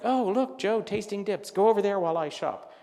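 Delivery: loud, high, excited urging; eager partner. Retold or imitated. Partner sending Joe off while he shops, eager. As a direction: play a partner excitedly spotting something. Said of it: "'cause he's like".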